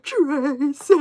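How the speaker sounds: high, loud, drawn-out cry of a name; over-the-top imitated plea to her sister. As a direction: call the name like a tearful reality-TV plea. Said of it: "that was really dramatic".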